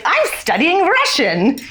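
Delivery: high-pitched, drawn-out mock protest; emphatic retold reply. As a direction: answer high and slow, playing up your younger self. Acted reply to professor's question.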